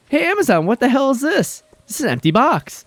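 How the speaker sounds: retelling the empty-box delivery, indignant; indignant complaint. Retold or imitated. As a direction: call Amazon out in disbelief. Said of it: "And I go".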